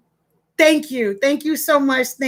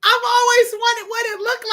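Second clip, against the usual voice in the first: excited; 8 semitones higher than usual. Real, not acted. Overjoyed after laughing fit.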